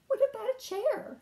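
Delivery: high, quick eager question; a hopeful little burst; eager frog. Acted. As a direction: pitch the idea brightly. Frog's hopeful idea.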